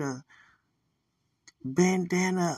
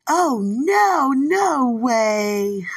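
high, loud, ringing "Oh, no, no way"; emphatic no way; 6 semitones higher, 11 dB louder, much slower than usual. Retold or imitated. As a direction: shut it down with a bright loud refusal. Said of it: "That's how they said it".

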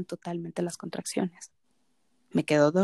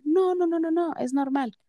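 mock dismissive nurse; 10 semitones higher than usual. Nurse dismisses husband, mimicked.

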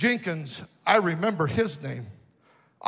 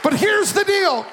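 full-force preaching; 5 semitones higher than usual. Acted. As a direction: stir up the young crowd. Preaching to the students.

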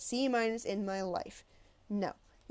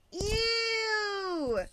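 put-on high girlish squeal of disgust; 12 semitones higher, 4 dB louder than usual. A narrator's reading. A girl behind him, grossed out by the story, squeals in disgust. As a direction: shriek out a grossed-out ew.

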